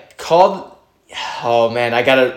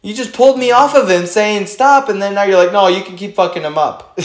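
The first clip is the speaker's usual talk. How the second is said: higher, exasperated mock protest at the ref; pitched-up, lightly acted disbelief; indignant fighter; 8 semitones higher than usual. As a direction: play the outraged fighter yelling at the referee. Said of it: "Matt Brown's going like".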